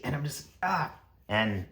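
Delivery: ordinary talk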